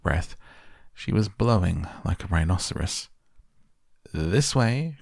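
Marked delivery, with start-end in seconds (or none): 4.1-5.0 high-pitched, light call. A narrator's reading. Guide hurrying the group along the corridor, calling out. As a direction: call the group onward without shouting. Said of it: "cried Mister Wonka".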